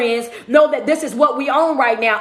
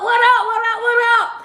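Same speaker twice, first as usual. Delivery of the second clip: high, drawn-together run of greetings in a raised voice; playful high shout-out; 8 semitones higher than usual. Real, not acted. Livestream host greets viewers after fumbling a name, playful high shout-out. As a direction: call it out high and playful, covering a slip.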